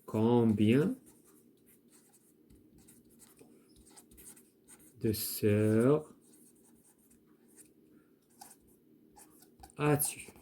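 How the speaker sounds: "Combien de sœurs as-tu" split with long pauses; slow dictation. Shown as a demonstration. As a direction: dictate "Combien" "de sœurs" "as-tu" slowly for the class to write. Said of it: "on peut dire".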